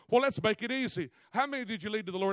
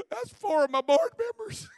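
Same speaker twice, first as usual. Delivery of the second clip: much higher, astonished pastor voice; pastor voiced; 14 semitones higher, 5 dB louder than usual. Pastor turning to the preacher, stunned and high-pitched. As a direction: squeak it out in disbelief. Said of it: "He goes".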